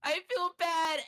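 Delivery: mock tearful cashier; imitating crying. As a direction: whine it like she is crying. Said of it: "she was, like, crying".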